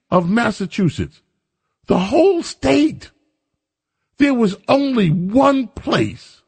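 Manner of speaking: entire drawn out, slow and rising high; stress on entire. Real, not acted. Vlogger stressing "entire" to viewers, slow and incredulous. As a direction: lean hard on "entire", slow and disbelieving. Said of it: "incredulous emphasis on entire state".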